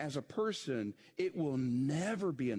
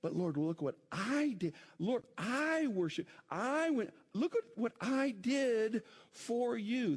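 high, long I in every claim; self-justifying plea, hammering the word I; stress on I; 8 semitones higher than usual. Retold or imitated. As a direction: stretch and punch each "I". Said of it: "the emphasis is on".

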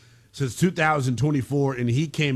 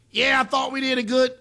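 high-pitched, held-up quarterback impression; Mahomes; 13 semitones higher, 4 dB louder than usual. Host asked by co-host for the impression, high mock quarterback voice. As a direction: put on a high athlete's voice, pitched well above your own. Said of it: "give me Mahomes again".

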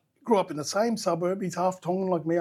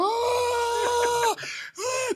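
high cracking wail of tears; re-enacting crying out in shock. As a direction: let out a high, overwhelmed sob-cry. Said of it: "crying around the corner".

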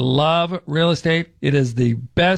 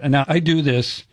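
ordinary talk

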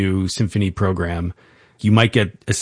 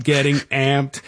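ordinary talk

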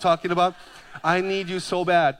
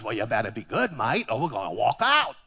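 lower, mock-tough Australian voice; lightly imitated Australian accent; 7 semitones lower than usual. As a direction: voice the Aussie host with a hint of a threat. The Aussie man threatens the preacher.

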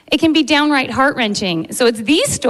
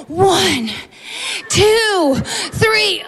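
rising higher on each count; effortful countdown; 7 semitones higher, much slower than usual. Acted. Doctor counting patient into the pull, building effort. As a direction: count up to a big heave. Acted countdown before pulling.

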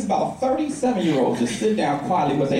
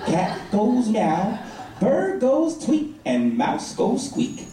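put-on very slow, spaced-out child's explanation; niece explaining; much slower than usual. Niece explaining animal sounds to her uncle, slowly and deliberately. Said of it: "And she goes".

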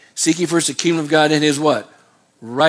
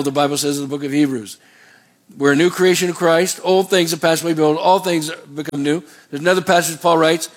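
normal talking voice

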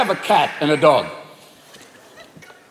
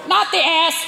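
parrot voice; 10 semitones higher, slower than usual. Acted. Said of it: "the parrot will go".

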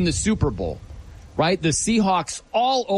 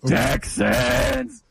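lightly imitating a stadium announcer's drawn-out call, higher; mock hype call of the team name, higher pitch; 8 semitones higher than usual. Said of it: "the guy in the loudspeaker just goes".

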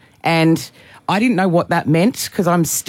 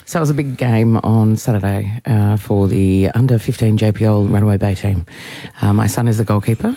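low, measured announcer delivery; 8 semitones lower than usual. Real, not acted. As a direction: read it out like a deep-pitched announcer. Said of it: "Is this your announcer voice?".

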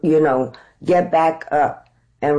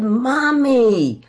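high-pitched plea to mommy; drawn-out, high insistent pleading; acted pleading; 8 semitones higher than usual. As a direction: plead your case in a much higher voice. Acting out her plea to mom.

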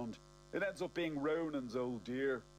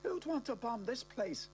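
baffled; 12 semitones higher than usual. Acted. Confused outside after scare.

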